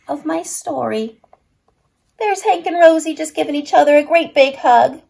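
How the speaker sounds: usual speaking voice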